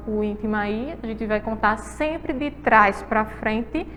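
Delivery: usual speaking voice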